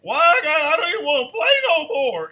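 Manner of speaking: high, loud whining; mock whining groan with a laugh; whiny complaint. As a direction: whine like you're too sore to play. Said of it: "I was like".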